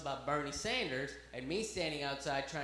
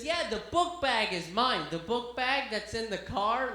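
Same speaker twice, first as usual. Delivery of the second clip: louder, higher, obliviously insistent; doggedly deflecting; 5 semitones higher, 5 dB louder than usual. Retold or imitated. Girlfriend keeps dodging the officer's question, loud stubborn deflection. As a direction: dodge by cheerfully repeating yourself. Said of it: "keeps going on and on about the book bag".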